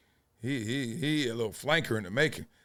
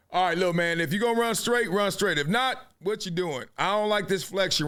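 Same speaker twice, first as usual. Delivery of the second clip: excited coach; 6 semitones higher than usual. Real, not acted. Coaching kid on film.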